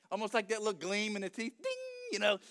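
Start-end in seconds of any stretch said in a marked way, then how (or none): 1.6-1.7 high, held ringing ding; imitated ding. Preacher imitating a gleam in the angel's teeth, high ding. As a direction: sing out a tiny sparkle chime.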